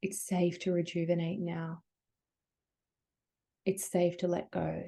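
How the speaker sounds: soft voice of the body. Pretend. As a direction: hush it gently, "safe" leaning soft. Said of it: "your body's just showing you messages".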